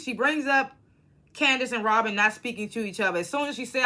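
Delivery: ordinary talk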